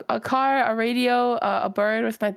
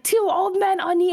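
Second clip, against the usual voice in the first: high, louder, pressing; insistent sister imitation; 9 semitones higher, 4 dB louder than usual. Imitating her younger sister pressing the clue, high and loud.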